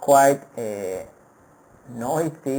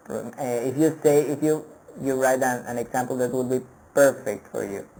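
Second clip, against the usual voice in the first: ordinary talk, nothing marked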